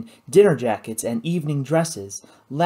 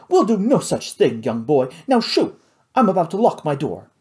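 high, imperious dismissal; snooty society lady; haughty lady; 4 semitones higher, 4 dB louder than usual. A narrator's reading. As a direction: shoo the servant away. Miss Simpkins dismisses boy.

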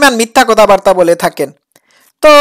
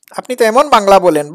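usual speaking voice

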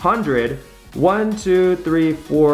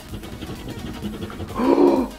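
sharp gasp; 9 semitones higher, 4 dB quieter than usual. Real, not acted. Revealing the prize.

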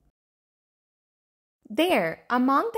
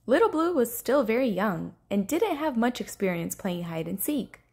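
usual speaking voice

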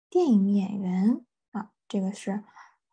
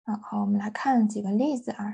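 ordinary talk

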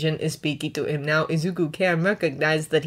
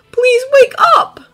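high, loud plea; desperate begging; 10 dB louder than usual. A narrator's reading. Boy to the dragon who will not wake, begging. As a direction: beg urgently, voice thrown right up. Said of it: "he begs for Mizuchi's sake, for his own sake".

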